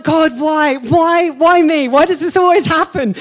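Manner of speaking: high, loud panicked wail; embarrassed guest wailing at an awkward TV scene; mock panic. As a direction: cry out to heaven in mock despair. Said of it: "And I was just like".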